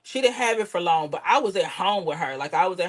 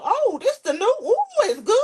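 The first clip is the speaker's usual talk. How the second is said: high, louder fake delight over the gum; mock enthusiastic; 4 dB louder than usual. Daughter acting out mother's gum trick, high fake delight. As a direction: oversell the gum like it's a treat. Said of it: "That's what she told me".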